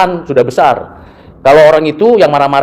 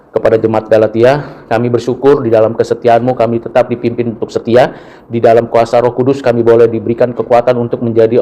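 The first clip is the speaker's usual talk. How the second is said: low, hushed prayer voice; closing prayer; 4 semitones lower than usual. Real, not acted. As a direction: pray humbly to close the sermon. Pastor closes with prayer. (doa penutup dengan suara rendah dan khusyuk)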